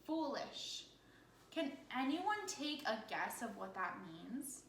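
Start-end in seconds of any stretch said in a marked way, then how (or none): none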